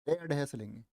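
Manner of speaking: ordinary talk, nothing marked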